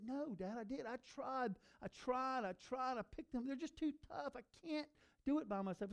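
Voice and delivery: put-on boy protesting he tried; pleading little boy. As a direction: beg your dad like a frustrated kid.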